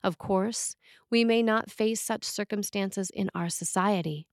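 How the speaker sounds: ordinary talk, nothing marked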